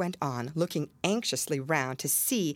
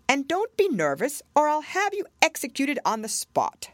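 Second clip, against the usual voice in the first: king voice; 10 semitones higher, 4 dB louder than usual. Acted. King threatens the Hatter.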